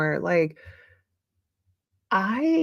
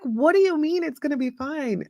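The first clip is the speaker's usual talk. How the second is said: higher, incredulous husband voice, indignant; mock the husband's disbelief, pitched up; exasperated husband; 8 semitones higher than usual. Imitating exasperated husband in story.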